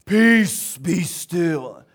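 loud, dramatic movie Jesus; movie-style command. Retold or imitated. Movie Jesus commanding the storm, hammed-up loud. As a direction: boom it like the films. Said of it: "if we watch the movies".

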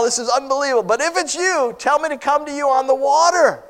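high, excited disciple calling out; amazed and daring, voice lifted high; plucking up courage. Acted. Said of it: "Peter gets up this courage".

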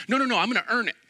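put-on a child digging in his heels; stubborn grandson. Grandson refusing grandma's gift, high and stubborn.

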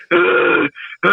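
long breath-heavy groan, mimicked; mock moaning in the act; imitated grunts. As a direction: groan like the man next door. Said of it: "the whole time he's like".

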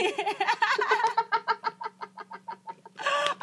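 high-pitched burst of real laughter; big laugh. Real, not acted. Co-host cracks up at the ghost joke, high laughter. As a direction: crack up, trailing on helplessly.

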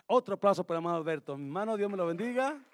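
loud, high-pitched rousing introduction; rally the room to welcome the next speaker; calling for applause. Real, not acted. Presenta al orador pidiendo aplausos. (presentación efusiva a voz en alto)

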